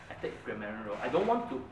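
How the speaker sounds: ordinary talk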